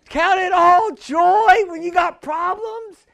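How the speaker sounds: slow, loud disbelieving question; incredulous. Pretend. Pastor echoing James in mock disbelief, slow and incredulous. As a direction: repeat the verse as if it's crazy. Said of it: "I'm like".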